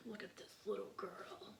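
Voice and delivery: soft whispered aside as God; God peering down, hushed; a hint of God. God voiced looking down.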